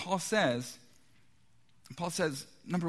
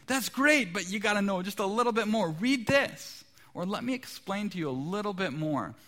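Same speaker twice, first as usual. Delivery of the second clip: pushy cheery missionary; 6 semitones higher than usual. Retold or imitated. Visitors at the door pressing the homeowner, hammed-up eager pitch. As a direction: keep selling with a bright, pressing smile. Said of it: "And they say".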